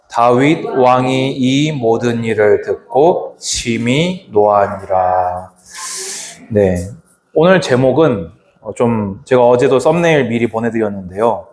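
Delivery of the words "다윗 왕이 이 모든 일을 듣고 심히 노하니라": unison scripture reading. Real, not acted. Pastor leading the congregation in reading a verse together, measured recitation. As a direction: read the verse slowly and evenly in unison. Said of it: "한 목소리로 합독합니다".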